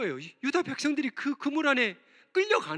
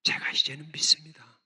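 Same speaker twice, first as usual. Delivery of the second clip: whispered confession; 7 semitones lower than usual. Acted. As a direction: whisper the vow of faith. Habakkuk's quiet confession. (속삭이는 신앙 고백)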